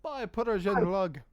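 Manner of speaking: see them off in a mock Slovenian accent. Merchant saying bye to a friend, in a put-on Slovenian accent. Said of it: "I can do a Slovenian accent".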